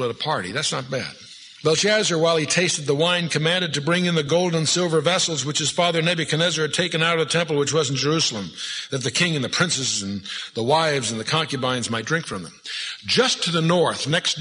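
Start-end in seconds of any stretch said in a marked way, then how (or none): 1.6-12.5 measured, solemn Bible reading; scripture reading. Acted. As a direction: pulpit reading of a Bible passage. Reads scripture aloud.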